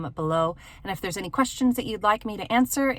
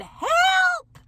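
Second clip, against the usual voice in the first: much higher, calling out for help; mock cry for help; 7 dB louder than usual. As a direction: call out for help from the snow. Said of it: "I think I even said".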